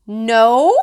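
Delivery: put-on a mild shouted toddler refusal. A narrator's reading. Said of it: "Fudge shouted".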